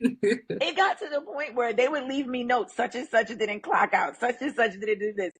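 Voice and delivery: laughing while telling how the team left her notes. Real, not acted. Manager laughing while recalling her team's snitching notes, laughing.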